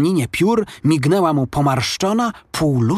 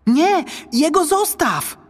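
high, drawn-out desperate plea; boy's high-pitched protesting call. A narrator's reading. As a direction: cry out to stop an attacker. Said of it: "zawołał Maciuś".

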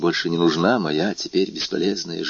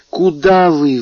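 sudden high cry of alarm; exclaiming; 7 semitones higher, 5 dB louder, slower than usual. A narrator's reading. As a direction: blurt it out, high and a little louder. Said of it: "воскликнула она". (испуганный возглас)

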